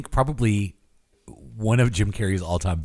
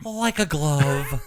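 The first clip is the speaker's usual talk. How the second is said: Ace Ventura quote; 5 semitones higher, much slower than usual. Retold or imitated. Quoting Ace Ventura line slowly.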